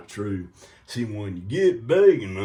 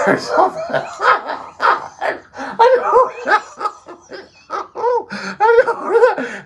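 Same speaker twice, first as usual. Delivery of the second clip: high, loud laughter through the words; giggling fit; laughing; 8 dB louder, slower than usual. Real, not acted. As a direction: lose it completely. Cracking up at own joke.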